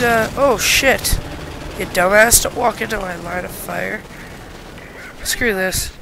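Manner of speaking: ordinary talk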